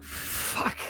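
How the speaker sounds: whispered, drawn-out curse; retold hushed curse. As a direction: curse under the breath, voiceless. Retold dismayed reaction in story.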